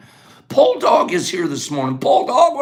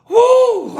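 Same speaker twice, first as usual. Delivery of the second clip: a loud, much higher whoop of exhaustion; breathless woo; 6 dB louder than usual. Real, not acted. Host winded after fast answers, breathless whoop. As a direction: blow it out like you're spent. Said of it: "I'm out of breath".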